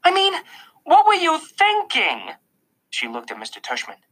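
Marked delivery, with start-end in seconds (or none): 0.0-2.4 high, drawn-out exasperated demand; raised voice. A narrator's reading. Mom to son after a fight, voice raised. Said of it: "raising her voice".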